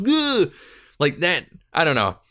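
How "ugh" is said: a sudden high yelled "Ugh!" like a raging gamer; mock rage grunt. To viewers, mocking YouTubers who smash headsets, high rage grunt. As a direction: fling out a fake gamer rage cry. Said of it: "and they're just like".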